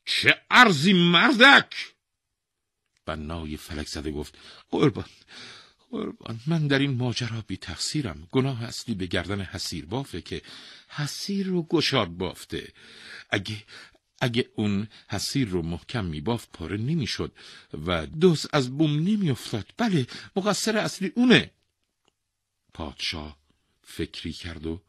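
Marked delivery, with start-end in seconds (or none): 0.0-1.7 slow, loud, high scornful snap; angry king demanding what the builder wants. Acted. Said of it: "با عصبانیت گفت". 4.7-21.5 higher voice protesting innocence; pleading builder. Acted. Builder pleading before the king.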